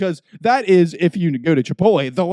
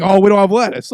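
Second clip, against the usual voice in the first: higher, louder breezy mock of the worker; hammed-up nonchalant staffer; mock casual worker; 4 semitones higher, 6 dB louder than usual. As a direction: toss it off like it's nothing. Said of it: "act like that's just a Monday thing".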